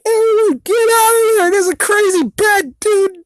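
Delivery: very high, loud cartoon yell; squeaky high-pitched shouted warning; Michelangelo yelling. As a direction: screech it out like a panicked turtle. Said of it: "and he's like".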